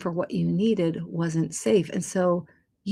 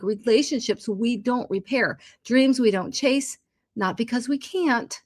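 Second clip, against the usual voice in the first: normal talking voice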